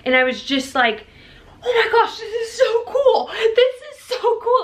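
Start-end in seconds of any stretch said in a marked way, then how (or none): 1.6-4.6 high, laughing, slowed delight; giddy excited squeal. Retold or imitated. New mom retelling her first look at baby, giddy laughing squeal. Overjoyed moment right after birth.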